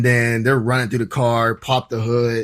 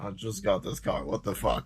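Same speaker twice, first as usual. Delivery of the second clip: muttered complaint; 4 semitones lower, 12 dB quieter than usual. Retold or imitated. Dog trampling new car seats.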